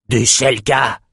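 grumbling warrior voice; growled. A narrator's reading. Warrior beside the king spots the enemy, growling. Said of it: "grogna un guerrier debout".

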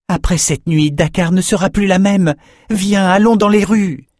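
high-pitched, rousing appeal; rally a reluctant friend to follow; urgent urging. Acted. Young fencer calling his friend into the streets, urging. Friend urges champion to join him.

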